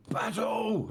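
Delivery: high shouted battle cry; dwarf bellowing battle. Acted. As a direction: yell it as you charge.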